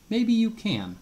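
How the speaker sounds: put-on Old Man Crow teases Coyote, slyly high. A narrator's reading. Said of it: "said Old Man Crow".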